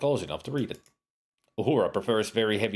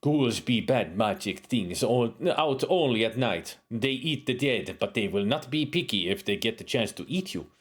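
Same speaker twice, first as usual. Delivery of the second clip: put-on accent slipping toward Jamaican; drifting Jamaican accent. Uhura warns the hero about ghouls in a drifting Jamaican accent. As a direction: let the foreign accent slide into Jamaican. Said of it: "almost giving you a Jamaican accent".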